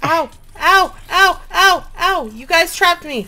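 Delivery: high loud ow cries; yelping in pain. Real, not acted. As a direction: yelp each ow as you're hit. Trapped and hit by mobs.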